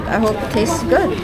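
usual speaking voice

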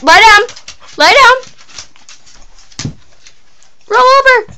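high and loud at the dog; high dog command. Real, not acted.

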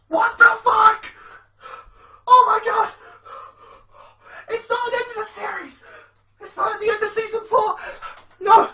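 high, loud yells, near tears with joy; disbelieving screaming. Real, not acted. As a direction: scream in real disbelief, close to crying. Fan still screaming in disbelief.

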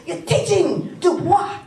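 high, slow mock of the agent saying Du Bois wrong; laughing imitation of the agent; agent mispronouncing Du Bois. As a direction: draw out his bungled Du Bois. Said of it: "he kept saying".

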